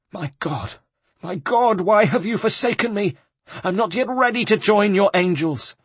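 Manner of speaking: high, panicked lament to God; despairing prayer. A narrator's reading. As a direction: wail to heaven in fear. Terrified abbot appeals to God.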